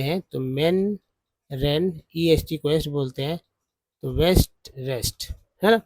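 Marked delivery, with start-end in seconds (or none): none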